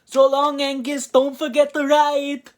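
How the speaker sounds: loud, high, mocking Scottish farewell shout; Colin's farewell. Reviewer voicing Colin waving off Angus, loud hammed-up Scottish shout. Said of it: "Colin says".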